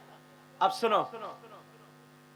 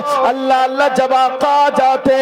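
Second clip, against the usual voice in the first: loud, high, impassioned sermon delivery; full force preaching; 8 semitones higher, 21 dB louder than usual. Acted. Preacher tells the crowd the Prophet's story, at full force.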